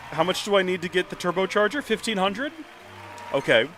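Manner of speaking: usual speaking voice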